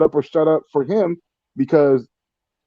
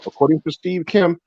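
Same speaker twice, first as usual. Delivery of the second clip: ordinary talk, nothing marked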